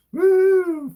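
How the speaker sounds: loud, high whoop of joy; loud happy whoop at the super chat; excited cheer. Real, not acted. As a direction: cheer the new donation out loud.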